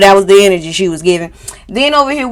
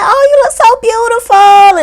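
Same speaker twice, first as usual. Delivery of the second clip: gushing; 13 semitones higher than usual. Retold or imitated. Friends gushing at a greeting.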